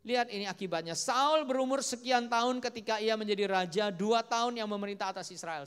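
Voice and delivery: loud scripture-proclaiming voice; forceful preaching. Acted. Preacher shows congregation Saul's short reign, forceful preaching. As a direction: proclaim the verse as a warning.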